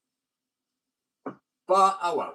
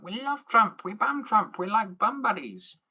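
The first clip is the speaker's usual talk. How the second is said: higher, louder mock fan voice; mocking Trump fans; 10 semitones higher, 6 dB louder, slower than usual. Mocking American Trump fans, loud gushing voice. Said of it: "You guys are like".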